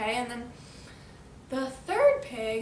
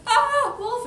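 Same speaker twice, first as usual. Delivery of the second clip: very high, loud storybook alarm; play-acted scream; 11 semitones higher, 9 dB louder than usual. Pig spots the wolf, high mock-terrified cry. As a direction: shriek it as a cartoon pig.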